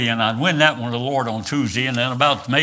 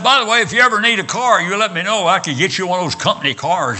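higher-pitched, quick, helpful salesman-like imitation; acted eager offer; 7 semitones higher, 4 dB louder, faster than usual. Ford manager offering his guest a company car, acted eagerness.

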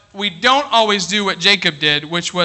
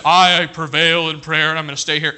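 a lightly voiced holier-than-thou believer; mock pious boast; 2 semitones lower than usual. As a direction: play the smug super-saint, lightly. Mocking super-spiritual people.

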